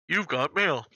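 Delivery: stuffed-up nose like a cold, imitating; the AOL guy sounding stuffed up with a cold. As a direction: say it like you have a head cold. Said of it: "stuffed up to me".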